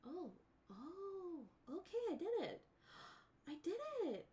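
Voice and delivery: high, breathless giddy voice; giddy relief. Retold or imitated. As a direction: squeak it out, proud and relieved. Excited self quoted after surgery.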